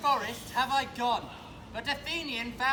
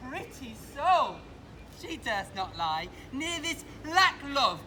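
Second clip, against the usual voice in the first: ordinary talk, nothing marked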